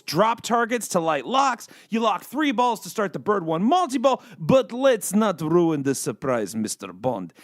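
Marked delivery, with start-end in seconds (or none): 4.4-7.3 slow, low mock-Russian Bond villain; terrible Russian accent. Teasing Bond with the game's surprise, in a terrible Russian accent. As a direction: drop low and drag it out in a bad Russian accent. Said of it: "I was doing a terrible Russian accent".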